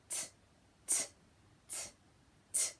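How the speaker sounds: short crisp t, t, t, t; phonics t sound. Shown as a demonstration. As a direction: tap out each t like a tennis ball. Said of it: "when I do this sound at school".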